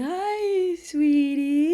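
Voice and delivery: high sing-song mimic of a sweet greeting; imitating Jake. Mimicking her boyfriend's bedside greeting, high and sing-song. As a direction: chirp it way up, like a doting boyfriend. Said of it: "Jake always coming and going".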